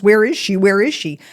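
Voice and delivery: repeated shouted question; shouting. Retold or imitated. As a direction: yell it at the dogs, desperate. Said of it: "you would have seen me shouting at my dogs".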